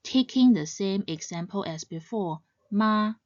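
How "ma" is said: even MA, neither rising nor falling; first tone. Shown as a demonstration. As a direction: say MA on one steady raised pitch. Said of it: "When read in the first tone".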